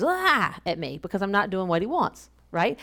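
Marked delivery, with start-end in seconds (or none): 0.0-0.6 high, loud mock cry; imitated child's grunt. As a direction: let out a toddler's wordless protest. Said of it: "He pinches me and he goes".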